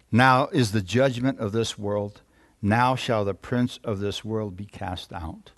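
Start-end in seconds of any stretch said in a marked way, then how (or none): 2.6-5.5 lower, softer reading voice; subdued, deliberate pulpit reading; scripture reading. Real, not acted. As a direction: read the Bible passage aloud with measured solemnity. Reads scripture aloud to congregation.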